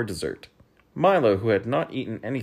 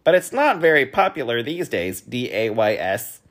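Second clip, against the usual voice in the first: loud, raised voice, slowing to spell; spelling bee voice; 4 semitones higher, 7 dB louder than usual. A narrator's reading. Spelling bee commenting, then spelling a word, loud. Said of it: "commented the spelling bee".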